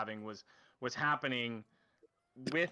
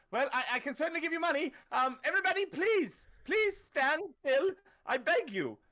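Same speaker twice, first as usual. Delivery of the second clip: much higher, begging with a nervous laugh; panicked town official; 13 semitones higher, 4 dB louder than usual. Acted. Official held at knifepoint begs the crowd to stand still, high and panicked.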